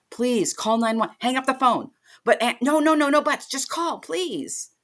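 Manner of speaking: begging him, no arguing; desperate pleading. Retold or imitated. Aunt begging her nephew to call, high and desperate.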